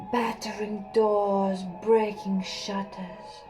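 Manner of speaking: plain reading voice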